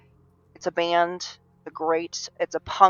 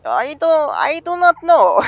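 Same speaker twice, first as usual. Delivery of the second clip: high, louder mock Russian accent; 10 semitones higher, 4 dB louder than usual. Answering what he's trying to sound like, in a Russian accent. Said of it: "I like to do the Russian accent".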